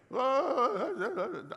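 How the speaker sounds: loud, high exaggerated wail; put-on high bawling; mock wailing. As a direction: wail like an attention seeker, hammed-up. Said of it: "you hear 'em wailing again".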